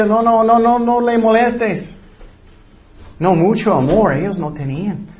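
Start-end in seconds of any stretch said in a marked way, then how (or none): 0.1-1.9 lightly voiced disciples, a touch higher, dismissive; disciples shooing children away. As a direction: brush the kids off like a busy gatekeeper.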